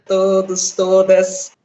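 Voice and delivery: choppy line cutting out; words freezing over bad connection; breaking up. Real, not acted. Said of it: "Você tá travando um pouquinho".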